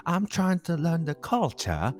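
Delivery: drawn out imitation of an Australian-English speaker; imitating the brother. Mocking the brother's Australian English for friends. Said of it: "he was like".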